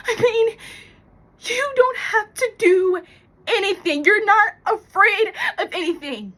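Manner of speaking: high, loud, crying outburst; overwrought, tearful and loud; tearful envy. Acted. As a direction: pour out envy on the verge of sobbing. Tearful envy of fearless plant.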